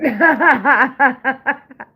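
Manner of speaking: high, loud cackle; real full laugh; loud burst of laughter. Real, not acted. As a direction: crack up at your own joke. Cracks up at own pun.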